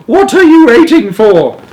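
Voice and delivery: high, loud, impatient scream; grandma screaming. A narrator's reading. Grandma urging Wonka to flee, screaming. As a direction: screech the question. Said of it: "screamed Grandma Josephine".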